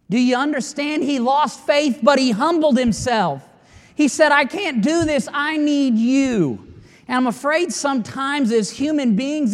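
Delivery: loud, high, fervent preaching; rising pulpit fervour; preaching at full force. Acted. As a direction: drive it home to the congregation.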